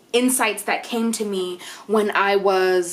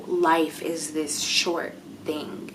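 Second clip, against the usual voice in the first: whisper; 6 semitones lower, 6 dB quieter, much slower than usual. Real, not acted. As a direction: drop to a breathy whisper on the last words. Whispered aside on life's shortness.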